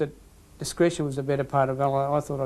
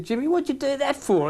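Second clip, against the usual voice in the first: much higher, exasperated umpire scolding; mock scolding; 11 semitones higher than usual. Umpire asking a player why he gave a free kick, pitched high. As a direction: pitch it way up like a baffled ref.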